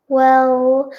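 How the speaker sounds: ordinary delivery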